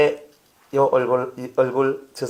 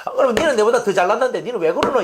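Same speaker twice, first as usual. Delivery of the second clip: resentful jab; 7 semitones higher, 4 dB louder, faster than usual. Retold or imitated. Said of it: "이러잖아요".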